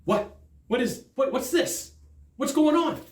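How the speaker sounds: mock bafflement, rising in pitch; baffled. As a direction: react bewildered to an interruption. Baffled at phone ringing mid-deal.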